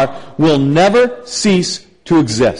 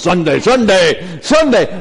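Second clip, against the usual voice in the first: monster truck ad; 3 semitones higher than usual. Retold or imitated. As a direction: hype it like a monster truck commercial. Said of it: "monster truck commercials".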